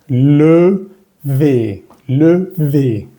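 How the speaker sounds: Levé as "leu-vé", rounded then spread; slow, correct French Levé; one vowel of each. Shown as a demonstration. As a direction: draw out Levé, contrasting the two vowels. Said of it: "sino una y otra".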